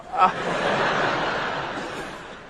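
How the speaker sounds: breathless unvoiced villain laugh; evil cackle. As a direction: wheeze out a gloating evil laugh. Evil giraffe gloats.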